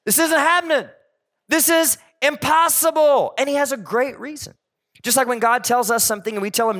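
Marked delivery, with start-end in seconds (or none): 0.0-3.3 higher, disbelieving protest; incredulous Zechariah. Retold or imitated. Zechariah telling the angel it cannot be, disbelieving. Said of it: "he's like".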